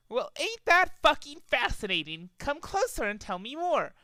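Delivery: high mock interest; sarcastic. A narrator's reading. Trixie mocking the interrupting pony, high and sarcastic. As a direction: drip with sarcasm.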